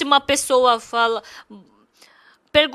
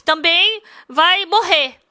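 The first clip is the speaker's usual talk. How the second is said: ordinary delivery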